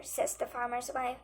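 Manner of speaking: ordinary delivery, nothing marked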